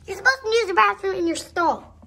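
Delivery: high, loud telling-off; indignant scolding. Acted. Scolding a horse over stall manners, loud and high. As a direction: raise pitch and volume to correct them.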